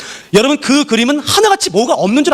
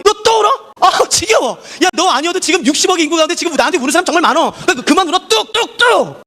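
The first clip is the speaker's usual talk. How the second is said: mock impatient God; 9 semitones higher than usual. Imagined God scolds a crying believer, much higher and impatient. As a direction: act out a fed-up God telling you to stop crying. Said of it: "울 때마다 하나님이".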